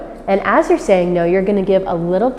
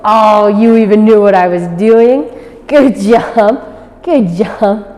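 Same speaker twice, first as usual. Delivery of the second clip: loud, bright praise; excited praise; 4 dB louder, slower than usual. Real, not acted. Trainer praising the puppy, loud and bright. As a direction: reward him warmly and loud.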